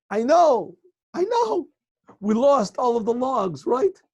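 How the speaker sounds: high, loud joyful cry; overjoyed husband shouting the good news; gleeful. Acted. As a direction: burst out with delight at the loss.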